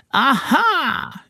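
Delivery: high mocking aha; mocking witch cry. A narrator's reading. Enchantress greets the tricked king's son, high mocking witch cry. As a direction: crow it high at him. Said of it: "she cried mockingly".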